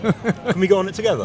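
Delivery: laughing. Real, not acted. Cheeky ask with laugh.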